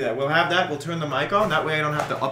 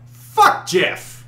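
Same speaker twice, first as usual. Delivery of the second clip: jokey outburst; 13 semitones higher than usual. Real, not acted. Shared joke shouted.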